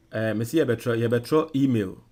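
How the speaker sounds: ordinary delivery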